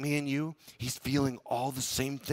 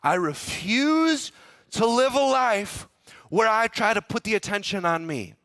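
firm refusal; 7 semitones higher, 4 dB louder than usual. Retold or imitated. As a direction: preach it, no pride allowed. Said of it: "he said".

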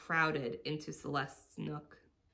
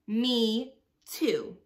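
chiming in; 4 semitones higher, 8 dB louder than usual. Acted. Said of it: "Ileana chimed in".

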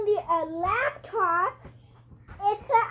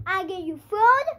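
normal talking voice

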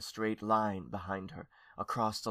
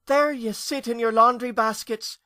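high, loud ghostly wail; 13 semitones higher, 8 dB louder than usual. A narrator's reading. Aunt scolding the little ghosts, wailing. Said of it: "she wailed at the little ghosts".

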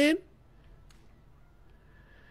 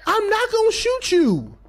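fake reassurance; 6 semitones higher, slower than usual. Real, not acted. Reassuring teammate, high voice.

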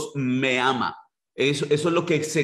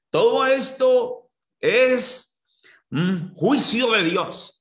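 high, slow pompous preacher voice; mock the self-styled prophets' verdict; mocking false prophet; 7 semitones higher, much slower than usual. Preacher mocking self-styled prophets who blame God, slow high pompous voice. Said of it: "a esos disque profetas".

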